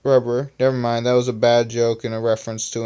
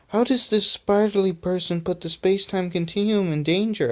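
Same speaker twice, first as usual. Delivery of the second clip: high-pitched, mildly scared question; 7 semitones higher, 3 dB quieter than usual. A narrator's reading. Rubber asks about the danger, mildly scared. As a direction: ask in a small, high, frightened voice. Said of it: "in a scared manner".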